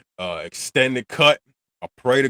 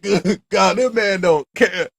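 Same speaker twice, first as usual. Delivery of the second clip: higher, slow, stunned exclaim; mock disbelief; 10 semitones higher, 5 dB louder, slower than usual. Reacting to Joe's coldness in the hospital, slow stunned exclaim. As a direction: marvel at the callousness.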